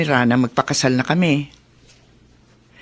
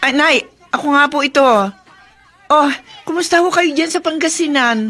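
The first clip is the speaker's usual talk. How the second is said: eager phone greeting; 9 semitones higher than usual. Acted. Greeting mother-in-law on phone.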